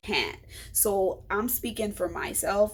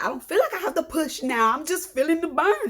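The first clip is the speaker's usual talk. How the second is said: mock pained protest; 8 semitones higher, 4 dB louder than usual. Retold protest in labour.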